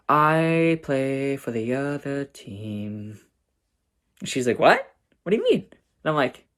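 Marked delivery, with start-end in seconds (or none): 0.1-3.2 very slow, stretched-out confession; drawn-out hint. Retold or imitated. Coded coming out to her sister. 4.6-5.7 high-pitched imitation of a baffled sister; puzzled surprise. Sister reacts puzzled to the hint.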